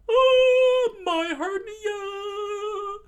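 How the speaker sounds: very high, loud, sung-out howl; hammed-up anguished cry; pained wail. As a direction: wail like a crushed cartoon victim. Sanji crushed, wails.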